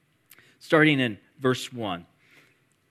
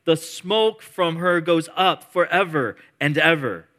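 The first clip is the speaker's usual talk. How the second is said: loud, higher, ringing proclamation; preaching praise; 5 semitones higher, 6 dB louder than usual. Acted. Pastor voicing the multitude's praise, loud proclamation. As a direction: declare it with full force.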